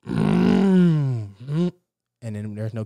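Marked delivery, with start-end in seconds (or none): 0.1-1.7 loud mock engine hum; imitated engine rumble. Acting out a car engine with a loud hum. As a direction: rev it out like a stalling engine. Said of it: "Cadillac converter stalling".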